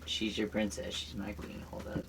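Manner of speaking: soft, low, murmured; quiet. Real, not acted. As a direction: say it under your breath. Drops to near whisper.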